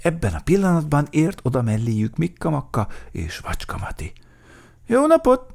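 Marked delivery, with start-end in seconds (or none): 4.9-5.5 quick, high, loud hello; brisk high-pitched newcomer; first character's greeting. A narrator's reading. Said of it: "mondták".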